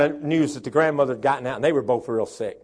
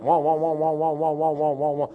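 sputtering saw. Retold or imitated. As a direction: mimic a stalling chainsaw. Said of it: "I don't want it".

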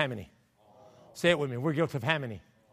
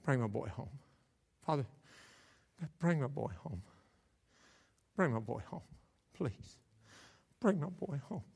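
hushed praying; 9 dB quieter than usual. Acted. The Father praying for his lost boy, hushed praying. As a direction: plead quietly, leaving long pauses between pleas.

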